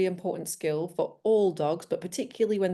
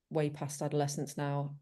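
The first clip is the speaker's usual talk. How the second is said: normal talking voice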